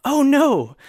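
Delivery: hammed-up high gasp of alarm; mock surprise. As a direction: fake shock, loud and high. Mock dismay at defense story.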